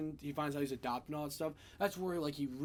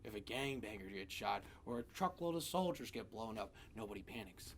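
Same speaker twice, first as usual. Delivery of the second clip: hint of Joker; 4 semitones lower, 8 dB quieter than usual. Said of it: "when he was talking about".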